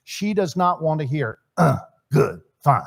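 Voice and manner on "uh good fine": terse, grunted answers; mock a husband who barely talks; curt caveman-style reply; grunting husband. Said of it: "It is like speaking in grunts".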